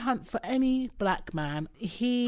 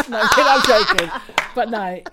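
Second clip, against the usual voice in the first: ordinary talk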